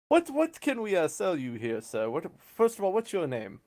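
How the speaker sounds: courteous dealer receiving a client; salesman in character. Acted.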